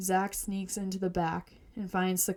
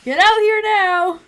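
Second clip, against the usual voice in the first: high, very loud yell; 12 semitones higher, 12 dB louder than usual. A narrator's reading. Shocked mom calls her husband out of the cooler, yelling. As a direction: yell it like summoning someone from another room. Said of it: "She yells".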